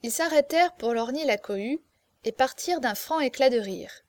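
ordinary delivery

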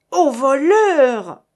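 slow, loud and high cry of alarm; ringing shout. A narrator's reading. Monsieur Hiller raising the alarm over the stolen bag, ringing shout. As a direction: bellow it so the whole hall hears. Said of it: "s'écria monsieur Hiller d'une voix éclatante".

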